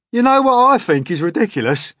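high, loud cheeky centipede; grinning centipede. A narrator's reading. Centipede grinning as it teases James, high and loud. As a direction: tease with a grin. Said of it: "grinning away as usual".

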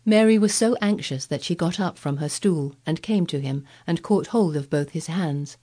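ordinary delivery, nothing marked

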